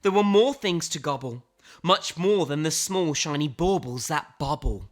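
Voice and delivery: ordinary delivery, nothing marked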